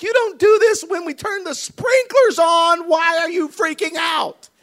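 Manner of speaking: loud, high, drawn-out dad rant; hammed-up frustrated shout at a child; exasperated yelling. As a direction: yell it slowly in disbelief. Exasperated dad yelling at screaming son.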